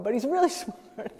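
laughing through the words; amused, breaking into a laugh. Real, not acted. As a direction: chuckle out really smart as a joke.

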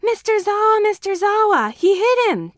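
put-on high, loud child shouting for the teacher; urgent child's cry. A narrator's reading. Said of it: "Tenya cries".

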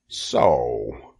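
low, drawn-out grudging word; disgusted. A narrator's reading. Rat eyes the egg sac, disgusted. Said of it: "he said in disgust".

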